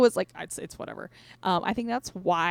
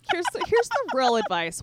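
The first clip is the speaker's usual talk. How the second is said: ordinary talk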